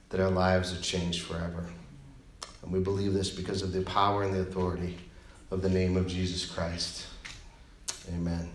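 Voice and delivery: low, hushed prayer voice, slowing toward amen. Acted. Pastor opening the sermon with a prayer, hushed and reverent.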